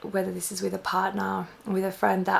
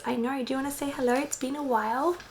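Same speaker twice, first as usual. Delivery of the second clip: pet talk; 5 semitones higher, faster than usual. Real, not acted. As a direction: coo at the cat in a sing-song. Greeting the cat.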